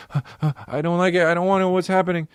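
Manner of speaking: put-on very high, whimpering child voice; high sniffly kid near tears; mock-crying little kid. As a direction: whimper it like a panicked toddler.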